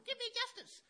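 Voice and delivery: high, loud desperate plea of the widow; pleading widow. Retold or imitated. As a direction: cry out for justice like a begging widow. Widow pleading, acted story.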